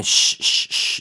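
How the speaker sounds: mock moviegoers' shushes; a string of drawn-out shushes; imitated shushing. Moviegoers hushing the laughing couple, imitated shushing. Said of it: "people start going".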